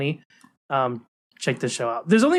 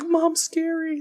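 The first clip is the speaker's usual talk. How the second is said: put-on high, scared little-girl voice; little Anya voice; 13 semitones higher, much slower than usual. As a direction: say it like a spooked kid. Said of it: "And she's like".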